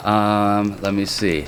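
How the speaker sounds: ordinary delivery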